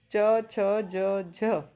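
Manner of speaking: च, छ, ज, झ each said very slowly and held long; drawn-out letter demo. Shown as a demonstration. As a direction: stretch every sound of च, छ, ज, झ slowly to show the long contact. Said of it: "इसे बोलने में एक तो अधिक समय लग रहा है". (च, छ, ज, झ बहुत धीरे, खींचकर)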